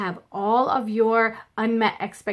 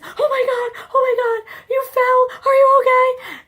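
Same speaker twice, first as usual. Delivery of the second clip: high, loud alarmed cry rising to the end; panicked mom; 7 dB louder than usual. Retold or imitated. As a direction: gasp in fright as if your baby is hurt. Panicked mom sees child fall.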